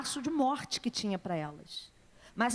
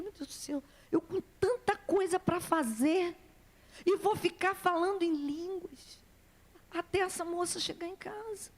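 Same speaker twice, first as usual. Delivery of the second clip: retold grumble, pitched up, dragging a little; reluctant protest; 5 semitones higher than usual. Preacher retelling her reply to the Holy Spirit, reluctant protest.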